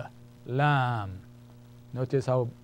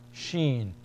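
usual speaking voice

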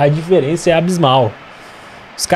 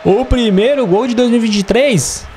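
goal celebration; 6 semitones higher, much slower than usual. Real, not acted. First goal scored, celebrating.

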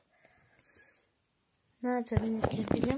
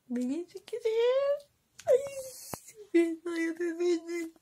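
put-on squealing girl; 6 semitones higher, 4 dB louder than usual. Acting an excited girl.

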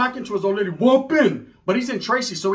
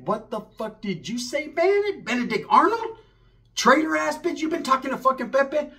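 outraged disbelief; 6 semitones higher than usual. Retold or imitated. Narrator accusing Sheila of betrayal, shocked and high. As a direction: pitch jumping much higher in shock.

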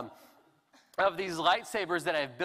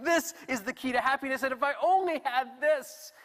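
high, louder, stretched-out wishing; intense longing; 9 semitones higher, 4 dB louder than usual. Acted. Thinking this is the key to happiness, fervently longing. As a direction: push it up high like you desperately want it. Acted longing for the one thing.

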